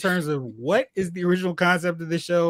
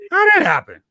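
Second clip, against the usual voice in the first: high, louder disbelieving question; disbelief; 12 semitones higher, 7 dB louder, much faster than usual. Real, not acted. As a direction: ask it in outrage. Outraged rhetorical question.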